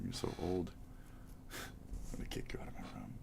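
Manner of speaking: whispered with a laugh at the start. Real, not acted. Whispering a mock threat to Gracie, giggly.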